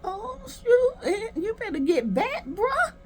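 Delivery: high, startled warning; mock alarm. Viewer warning him to back off, high mock alarm. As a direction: squeal a playful warning at him. Her alarmed reaction to the quarrel.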